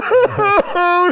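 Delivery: mock sob. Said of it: "we cried".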